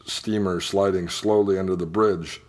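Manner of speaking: ordinary delivery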